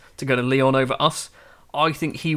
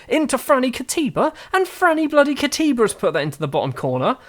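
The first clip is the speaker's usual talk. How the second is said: raised pitch, excited football commentary; excited goal call; 9 semitones higher than usual. Real, not acted. Live commentary as goal goes in.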